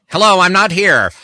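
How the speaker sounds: mock phone answer. Joking into the phone.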